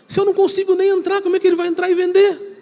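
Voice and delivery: put-on high astonished doubt of a young salesman; incredulous. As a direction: exclaim like you can't believe it. Disbelieving self inner voice.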